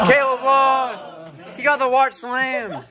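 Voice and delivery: slow, loud, high proclamation; excited announcement. Real, not acted. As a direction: call it out like a ringside announcer. Announcing the match result.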